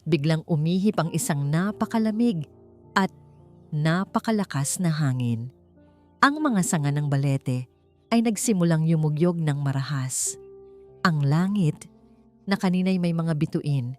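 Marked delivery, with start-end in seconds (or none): none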